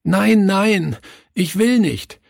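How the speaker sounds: put-on high, sobbing child's "nein, nein"; frightened child sobbing no; sobbing refusal. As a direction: plead through tears, high and desperate. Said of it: "schluchzte er".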